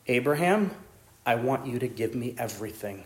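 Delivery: low, soft, solemn voice of God; hushed divine command. Acted. God asks Abraham to give up everything, hushed and solemn. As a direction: drop right down, grave and measured.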